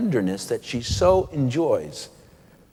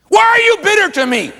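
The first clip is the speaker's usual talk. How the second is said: loud, high confrontational demand; the wrong way, shouting it at him; much louder and much higher accusation; accusing; 7 dB louder, faster than usual. Shown as a demonstration. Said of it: "No, just, just really something gentle".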